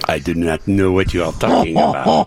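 mock French accent, deliberate. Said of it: "two French men".

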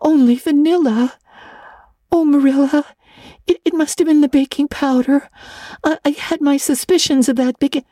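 put-on high, stammering girl's excuse; mortified. A narrator's reading. Anne blames the baking powder to Marilla, mortified. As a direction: plead and stumble over the words, pitched high. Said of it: "scarlet with mortification after tasting the cake".

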